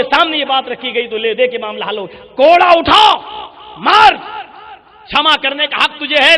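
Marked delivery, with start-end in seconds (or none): 2.4-4.2 loud, higher order to pick up the whip and strike; stern command. Acted. Umar orders the hesitant boy to strike, loud stern command. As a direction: bark it out as the caliph's command.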